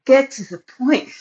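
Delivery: high, pushy get-on-with-it; impatient thought. Retold or imitated. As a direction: urge her to hurry up, mock impatient. Impatient inner thought at vet.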